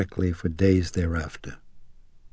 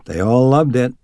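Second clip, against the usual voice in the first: raised, higher voice called downstairs; mother calling down to the cook from the stairs; 5 semitones higher, 6 dB louder than usual. A narrator's reading. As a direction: call it down the stairs, cheerful. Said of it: "she called down".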